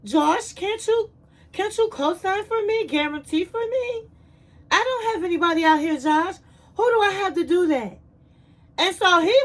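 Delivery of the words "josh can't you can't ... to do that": higher pleading imitation, quickening at the end; whiny pleading. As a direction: beg him like a needy girlfriend. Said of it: "she was like".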